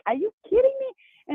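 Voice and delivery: incredulous retort. Retold or imitated. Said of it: "I, I was like".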